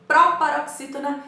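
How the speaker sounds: teacher stressing proparoxítona; emphasized term. Shown as a demonstration. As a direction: hit proparoxítona hard and high for the class. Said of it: "ouviu a Pro falar duzentas e trinta e sete vezes".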